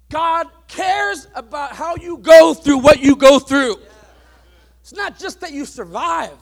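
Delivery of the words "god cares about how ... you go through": louder, driving the point home to the congregation; full-force preaching. Acted. Preacher warning the congregation about unsteady leaders, loud preaching. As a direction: preach it hard from the pulpit.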